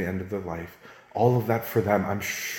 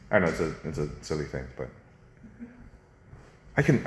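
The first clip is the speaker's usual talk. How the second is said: ordinary delivery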